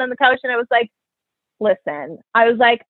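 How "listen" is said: low, serious "listen"; serious appeal. Retold or imitated. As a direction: drop the voice to open a serious talk. Sits him down to talk.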